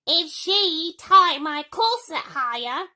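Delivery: high, loud, slow grizzling whine. Acted. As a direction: Gertrude complaining about her corset. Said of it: "grizzled Gertrude".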